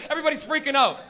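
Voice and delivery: usual speaking voice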